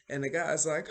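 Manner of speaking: ordinary talk, nothing marked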